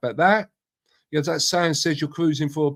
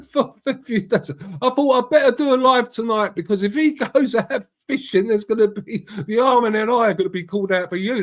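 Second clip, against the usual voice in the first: laughing; 8 semitones higher, 4 dB louder than usual. Real, not acted. Laughing about Paul's jokes.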